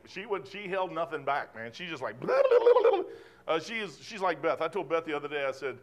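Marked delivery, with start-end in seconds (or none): 2.2-3.5 high squeaky blah-blah; chatter mimicry. Mimicking Rebecca spilling everything to Eliezer, squeaky chatter. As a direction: rattle off nonsense like a chatterbox.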